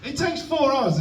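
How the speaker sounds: high-pitched comic exasperation; exasperated protest. Acted. Comic ranting to audience about cooking, exasperated. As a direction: pitch it up in disbelief.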